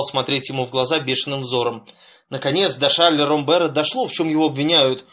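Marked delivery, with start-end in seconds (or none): none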